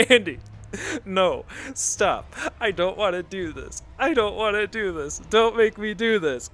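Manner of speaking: high, loud mock pleading; retold screaming. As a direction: imitate a friend's panicked, screamed begging. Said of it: "And she's screaming".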